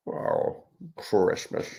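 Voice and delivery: a light sneering Grinch voice; a soft mock-grumpy Grinch; Grinch impression. Author doing the Grinch for live viewers who asked. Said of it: "Jim Carrey Grinch impersonation".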